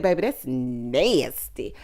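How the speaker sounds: low, slow, drawn-out disgust; deep, slow disapproving verdict; mock disgust. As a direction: drag it out, thoroughly grossed out. Quotes own disgusted reaction.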